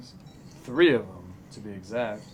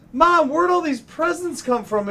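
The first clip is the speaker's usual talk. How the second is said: put-on high-pitched excited child voice; child on Christmas morning; 13 semitones higher, 4 dB louder than usual. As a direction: pipe it up like a wide-eyed kid. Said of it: "like a child on Christmas morning going".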